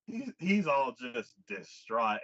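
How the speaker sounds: ordinary talk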